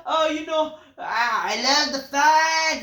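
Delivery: high, loud, slow drawn-out mock promo; mocking Pentagon. Reviewer parodies the wrestler thanking fans, high and loud. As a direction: stretch it out high and loud, hammed-up. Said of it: "they say".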